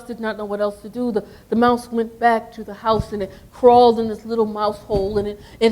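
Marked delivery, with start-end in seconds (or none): none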